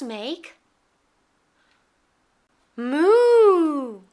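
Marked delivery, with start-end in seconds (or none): 2.7-4.0 loud playful moo; cow moo. Pretend. Cow sound for toddlers.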